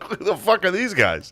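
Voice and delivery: baffled through a laugh; laughing bewilderment. Retold or imitated. Retold bewildered reaction.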